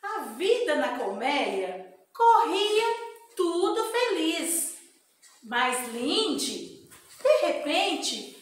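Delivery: lively storybook narration; reading to children. Acted. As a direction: read the bee story to kids.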